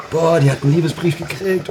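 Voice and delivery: high, excited gossip; gossiping schoolgirl. Retold or imitated. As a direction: squeal it like a nosy classmate.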